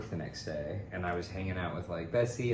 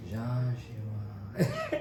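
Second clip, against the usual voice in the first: half-laughing, airy realization of the name; stunned recognition; 2 semitones higher than usual. Retold or imitated. Retelling to his friend, laughing at the realization. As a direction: say the name like it all just clicked.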